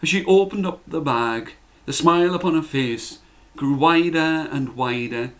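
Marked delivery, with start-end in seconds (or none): none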